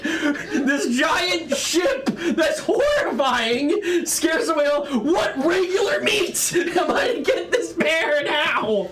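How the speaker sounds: high-pitched exasperated rant; indignant complaint, drawing out the words; angry. Acted. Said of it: "Orak is mad at you".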